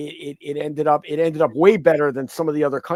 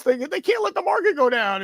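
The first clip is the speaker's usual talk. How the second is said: mock panicked whine; 12 semitones higher than usual. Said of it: "they're, like, crying".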